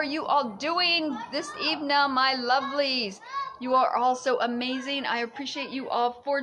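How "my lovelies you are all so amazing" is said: normal talking voice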